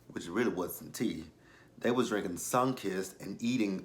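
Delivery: ordinary delivery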